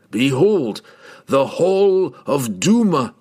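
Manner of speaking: grand proclamation. A narrator's reading. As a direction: proclaim it like opening a treasure vault. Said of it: "the words stuck in his throat".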